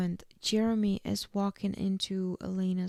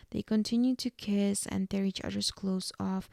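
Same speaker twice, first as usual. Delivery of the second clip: normal talking voice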